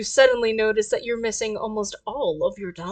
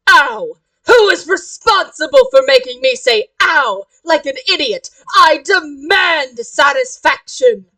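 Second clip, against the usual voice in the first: loud, indignant theatrical demand; haughty outrage; 4 semitones higher, 8 dB louder than usual. Acted. Omen rages at clumsy cosplayer, haughty outrage. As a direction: explode with punched words, stretching the final demand. Bumped character demands satisfaction.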